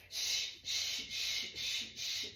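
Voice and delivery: long, steady, repeated "shh"; soothing shush. Shown as a demonstration. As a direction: keep hushing while patting the baby. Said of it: "just down to using the shh".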